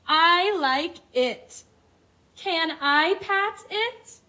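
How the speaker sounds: slow, bright storybook read-aloud; reading to children. Acted. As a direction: read the book slowly to little kids. Reads picture book to class.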